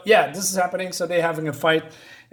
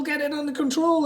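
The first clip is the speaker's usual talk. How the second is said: screaming order; 11 semitones higher than usual. Acted. Villain keeps screaming at his pilot, high panicked yell. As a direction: shriek the order again. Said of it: "And he screams".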